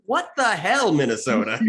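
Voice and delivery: sharp high disbelieving cry; baffled outcry. Retold or imitated. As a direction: toss it out like everyone's stunned reaction. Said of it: "the universal reaction is".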